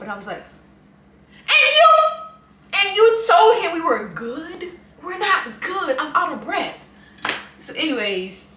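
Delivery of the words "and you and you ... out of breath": high, loud exasperated yelling; indignant, out-of-breath rant at a friend; frustrated outburst. Retold or imitated. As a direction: blow up in disbelief, pitch way up. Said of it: "she was a little frustrated".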